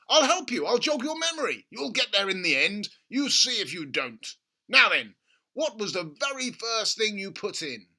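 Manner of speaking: gushing over a money-making idea; excited father. A narrator's reading. Father urging his son at breakfast, excited. Mr Cranky voiced.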